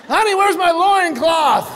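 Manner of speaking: hammed-up puzzled husband; mock husband. Husband asking his wife for a loincloth, mock-earnest.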